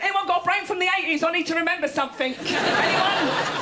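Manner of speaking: high-pitched mock panic; hammed-up desperate appeal; frantic plea. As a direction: call out to a room for urgent help. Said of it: "people running into rooms going".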